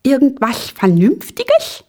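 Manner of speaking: high, puzzled little question; tiny bewildered voice; at a loss. A narrator's reading. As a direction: squeak it back, baffled. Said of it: "fragte Ping-Pong ratlos".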